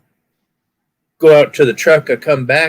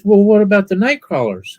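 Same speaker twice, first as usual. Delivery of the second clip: 1 cashier lady; 9 semitones higher than usual. Retold or imitated. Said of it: "she goes".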